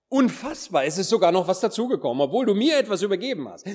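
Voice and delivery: high, quickening disbelief at the gift; marvel, high and hurried, with weight on "mir"; amazed emphasis. Shown as a demonstration. Preacher shows how to read the servant's words, amazed emphasis. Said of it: "sondern die Betonung ist darauf".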